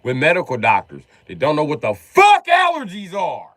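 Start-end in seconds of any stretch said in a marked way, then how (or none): 1.9-3.5 sudden high, loud outburst; exasperated shout. Acted. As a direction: blast it out in comic exasperation. Exasperated outburst about doctors.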